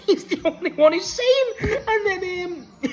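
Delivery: high, slow, helpless laughing delivery. Real, not acted. Reviewer amused by baffled Andrade, laughing. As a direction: get the words out through real laughter.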